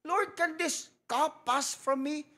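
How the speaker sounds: slow, high, pleading prayer; Jesus praying. Retold or imitated. Jesus kneeling in Gethsemane begging the Lord, slow and pleading. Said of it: "Sabi nga ni Jesus Christ".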